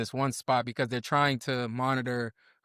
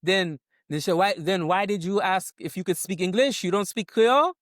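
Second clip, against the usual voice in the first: high, loud, indignant nurse; 6 semitones higher, 4 dB louder than usual. Retold or imitated. Retold nurse scolding the new parents, indignant and loud. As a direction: play the offended nurse demanding answers. Nurse annoyed at not speaking Creole.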